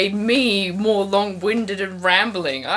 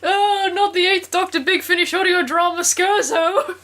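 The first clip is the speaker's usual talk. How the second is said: mock dismay; 10 semitones higher than usual. Mock despair at a reference.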